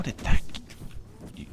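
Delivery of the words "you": breathless whispered insult. Real, not acted. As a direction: hiss it under your breath. Muttered insult at boss.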